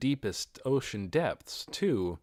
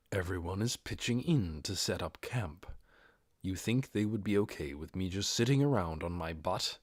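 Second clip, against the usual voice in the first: hushed near-whisper, softer; lowered voice; 4 semitones lower, 5 dB quieter than usual. A narrator's reading. Ainz quietly reasoning with Narbrul, hushed whisper. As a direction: keep it low so the others can't hear. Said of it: "lowered his voice".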